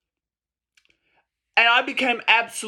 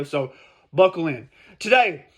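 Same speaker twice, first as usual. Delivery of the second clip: normal talking voice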